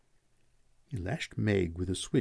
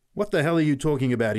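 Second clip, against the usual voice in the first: loud, fast, higher outburst; angry shout; 6 semitones higher, 7 dB louder, faster than usual. A narrator's reading. Pat turns on the boy, shouting angrily. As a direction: shout it in irritation. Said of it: "he shouted".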